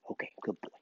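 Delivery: breathy whisper to the dog; gentle whispered praise. Real, not acted.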